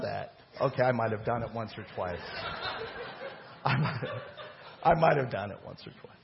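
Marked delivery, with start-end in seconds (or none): 2.2-3.3 soft laugh. Real, not acted. Laughs at own confession. 3.6-6.1 laughing admission. Real, not acted.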